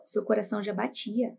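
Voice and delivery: ordinary delivery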